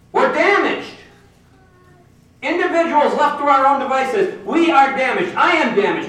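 preacher confessing his own brokenness with fervour; fervent preaching. Acted. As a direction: drive the point home from the pulpit.

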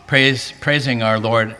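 ordinary delivery

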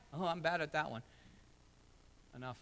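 usual speaking voice

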